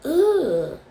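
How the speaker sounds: loud, high disgusted ugh; hammed-up groan of disgust; mock disgusted ugh. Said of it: "you would still say".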